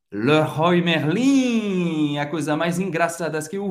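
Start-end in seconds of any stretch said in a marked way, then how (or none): 0.1-2.2 Leroy Merlin said slowly, ler-wa mer-lan; French pronunciation. Shown as a demonstration. Teacher announces the third brand slowly. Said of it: "Nossa terceira marca é".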